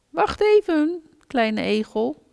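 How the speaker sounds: high, loud call after the little one; loud high worried call; big hedgehog calling out. A narrator's reading. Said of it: "riep de grote egel".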